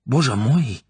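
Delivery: a high, loud startled cry; shocked gasp. Acted. Tatiana hearing the man is an English spy, shocked. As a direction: cry out in horror.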